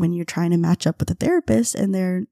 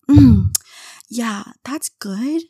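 friend tasting; 4 semitones higher, 6 dB louder, much slower than usual. Retold or imitated. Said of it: "she's like".

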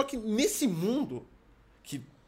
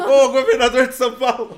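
teasing; 3 semitones higher, 10 dB louder than usual. Pretend. Teasing friend as governor.

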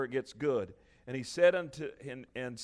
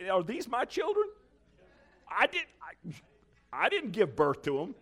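laughing indignant Moses; 8 semitones higher, 3 dB louder than usual. Retold or imitated. Moses voiced complaining, laughing.

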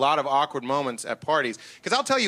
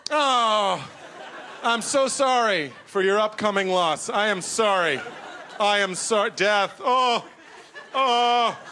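mock sympathy; 3 semitones higher, slower than usual. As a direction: ham up fake condolence. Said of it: "I'm just like".

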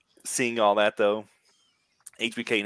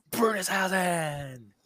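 ring announcer shout; 9 semitones higher, 3 dB quieter, much slower than usual. Pretend. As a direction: belt the name like a ring announcer. Announcer calling wrestler's name.

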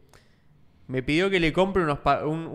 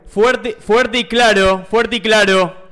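loud, drawn-out radio call; over walkie talkie; 6 semitones higher, 10 dB louder, slower than usual. Pretend. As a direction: bark it into the walkie talkie like a pilot. Radio talk banter.

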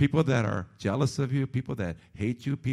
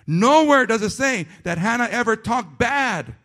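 loud, higher preaching cadence; preaching at full force; 7 semitones higher, 7 dB louder than usual. Acted. Pastor pressing the point on his congregation, loud full-force preaching. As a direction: drive the point home from the pulpit.

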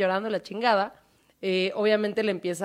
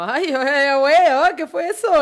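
startled gasp; 5 semitones higher, 7 dB louder than usual. Retold or imitated. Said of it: "ella así como".

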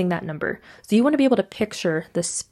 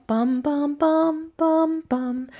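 sung piano imitation; 7 semitones higher, much slower than usual. Shown as a demonstration. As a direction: sing bum like piano keys. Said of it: "play the keys on the piano".

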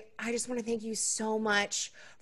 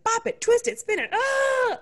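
much higher, mock toy announcer calling out commands; Bop It toy voice; 13 semitones higher, 3 dB louder than usual. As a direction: play the Bop It game voice, high and bright. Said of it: "touching my body like a bop it".

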